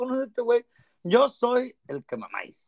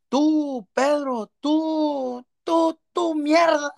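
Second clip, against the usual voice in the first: shouting grandma; 10 semitones higher, much slower than usual. Retold or imitated. Grandma yelling, forgot grandson's name.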